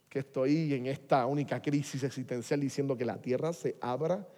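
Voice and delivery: normal talking voice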